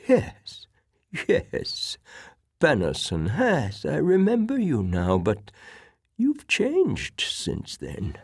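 put-on high, slow, wavering-pitched old man; old sick admiral recalling. Old admiral recognises his former captain, feeble old man's voice. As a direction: play an elderly admiral slowly placing a face.

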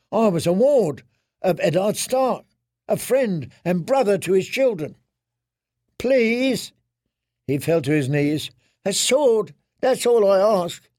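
a ruined man begging the gods for a sword; broken Theon pleading. Acted.

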